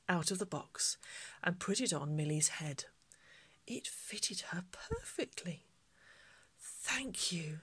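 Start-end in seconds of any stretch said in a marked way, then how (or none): 3.7-5.7 soft storytelling whisper; hushed narration. A narrator's reading. Vicar reading the hat moment to children, hushed whisper. 6.6-7.6 soft whispered "Thank you". Acted. Millie thanking the shopkeeper, soft whisper.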